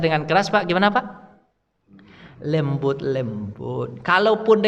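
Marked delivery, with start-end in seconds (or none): 2.4-4.0 gentle. Shown as a demonstration. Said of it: "nasihati aja dengan lembut-lembut".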